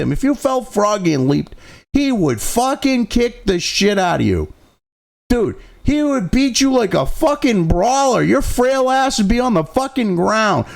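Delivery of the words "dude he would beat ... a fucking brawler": ordinary talk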